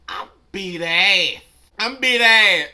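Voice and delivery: screaming a threat at the top of the lungs; furious shout. Retold or imitated. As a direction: bellow it like you're ready to fight. Threatening to fight, repeated.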